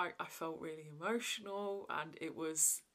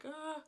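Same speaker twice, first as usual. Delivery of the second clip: mock dazed gasp; 10 semitones higher, 4 dB quieter than usual. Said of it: "walking around like".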